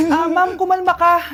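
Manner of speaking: normal talking voice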